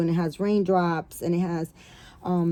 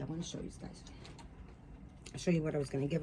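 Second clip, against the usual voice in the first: whisper; 4 semitones lower, 14 dB quieter, much faster than usual. Real, not acted. Aside, unvoiced.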